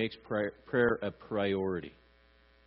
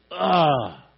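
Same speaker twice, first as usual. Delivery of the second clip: loud, raised "ah" of turmoil; wordless groan; 4 semitones higher, 8 dB louder than usual. Acted. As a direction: groan out what you can't put into words. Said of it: "All I'm doing is just".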